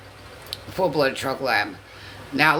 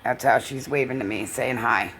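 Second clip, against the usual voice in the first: ordinary delivery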